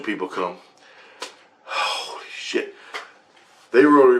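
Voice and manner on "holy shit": slow stunned whisper. Real, not acted. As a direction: breathe it out in awe. Awed reaction to the report.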